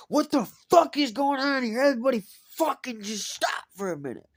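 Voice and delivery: loud high commanding yell; president's shout. Retold or imitated. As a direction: bark it to stop the whole brawl. Club president breaking up fight.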